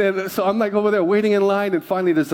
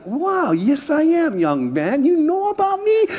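slow, puffed-up reply swooping very high; mock self-important delight; 9 semitones higher, slower than usual. Youth pastor thrilled the boy knows him, hammed-up high delight. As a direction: ham up being flattered, ending in a high squeal. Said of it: "And I'm like".

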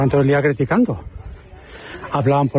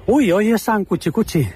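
higher-pitched mock announcement; anuncio en voz alta; 7 semitones higher than usual. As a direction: call it out as an office in-joke, pitched up. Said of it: "decíamos en alto". (anuncio burlón en tono más agudo)

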